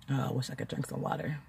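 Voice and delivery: very soft, low, hurried wish; under-the-breath longing; faint wishful murmur. Retold or imitated. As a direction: barely voice it, like a private thought. Retold thirsty thought on waking.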